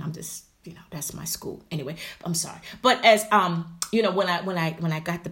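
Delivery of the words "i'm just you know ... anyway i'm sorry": unvoiced sheepish aside; whispered aside. Real, not acted. Apologizing to viewers for the school shoutout, whispered. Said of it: "whispered aside".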